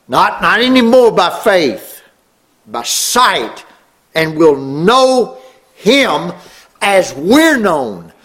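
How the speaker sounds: high, slow, ringing proclamation; climactic preaching. Real, not acted. Preacher promising congregation sight of the Lord, climactic. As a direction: lift the voice and stretch out every key word.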